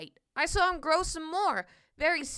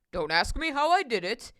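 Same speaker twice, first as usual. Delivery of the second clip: Mister Carp's voice; 2 semitones lower, 3 dB louder than usual. A narrator's reading.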